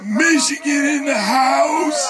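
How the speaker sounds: stretched-out rally holler; hype shout. Real, not acted. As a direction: pump up the crowd like an MC. Rally leader hypes the crowd.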